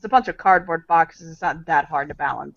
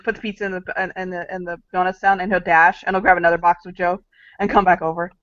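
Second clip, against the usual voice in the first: ordinary delivery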